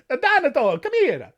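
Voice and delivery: high, hammed-up Italian accent calling; Italian-accented aunt summoning the boy, mock stern; aunt calls Donato over in a high hammed Italian accent. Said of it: "I had to break out the Italian".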